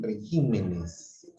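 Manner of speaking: regímenes said slowly, weight on the -gí- syllable; stress shift demo. Shown as a demonstration. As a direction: draw out regímenes, leaning on gí. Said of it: "con el cambio del, de, este, la sílaba tónica". (regímenes lento, marcando la sílaba gí)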